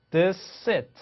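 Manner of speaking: "this seat" as thi-seat, slowly; single merged S. Shown as a demonstration. Teacher telling learners how, "this seat" with one S. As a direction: drop the first S of "this seat". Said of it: "이렇게 하시면 돼요".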